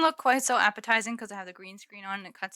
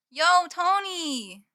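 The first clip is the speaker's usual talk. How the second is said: calling out; 8 semitones higher, 9 dB louder, much slower than usual. Real, not acted. Calling out to a friend.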